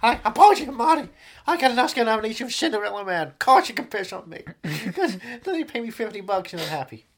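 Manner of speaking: high, pushy actor pitching himself; Paul Giamatti impression. Actor propositioning passers-by in New York, high voice. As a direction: keep the pitch high and eager.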